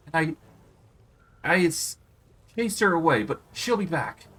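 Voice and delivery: high, whiny, sulking queen; whiny, petulant. A narrator's reading. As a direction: sulk and whine at the insult. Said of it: "says in a whiny, petulant voice".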